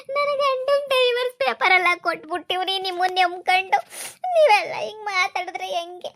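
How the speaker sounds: jilted woman begs the man not to leave her; tearful pleading. Acted. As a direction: beg him through sobs.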